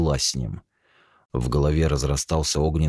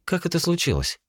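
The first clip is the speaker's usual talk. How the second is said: higher, urgent prompting; impatient. Acted. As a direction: push him to go on. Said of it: "нетерпеливо подтолкнула".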